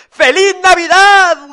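high, loud, drawn-out cheer; piglets' shout. A narrator's reading. Three piglets at the door at Christmas, high joyful shout. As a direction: holler the greeting from the doorway. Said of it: "gritaron los tres cerditos desde la puerta".

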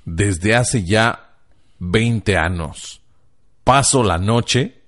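slow word-by-word reading; slow deliberate reading. Shown as a demonstration. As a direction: spell it out slowly as written. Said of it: "te lo voy a leer tal y como dice aquí".

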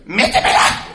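loud, high, hammed-up woman's cry of passion; mock woman moaning. Neighbour woman heard through the wall, hammed-up loud high moan. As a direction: overplay a woman's lustful cry. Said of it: "Y la pinche vieja decía".

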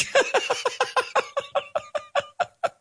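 breathy giggle at own pun; high airy laugh. Real, not acted. A teacher laughs at his own pun, airy and high.

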